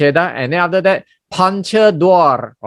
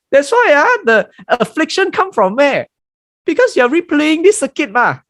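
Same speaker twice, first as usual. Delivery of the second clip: high, emphatic teaching voice; preaching; 12 semitones higher than usual. Acted. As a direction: drive the point home, much higher. Raised preaching point.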